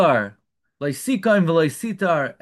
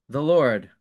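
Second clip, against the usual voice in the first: ordinary delivery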